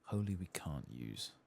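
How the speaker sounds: ordinary talk, nothing marked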